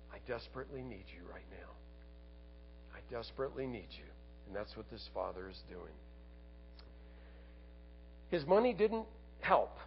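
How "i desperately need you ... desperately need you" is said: soft, low, quick plea; acted humble plea, hushed and low; humble prayer. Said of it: "humble yourself and say".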